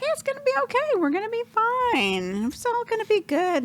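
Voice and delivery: very high singsong mock reassurance; chirpy reassurance. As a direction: imitate her reassuring him in a high voice. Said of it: "she was like".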